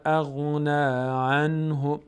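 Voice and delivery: drawn-out chanted phrase; slow recitation. Shown as a demonstration. Teacher recites the phrase for students, chanted. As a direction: stretch it in recitation. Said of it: "recites verse".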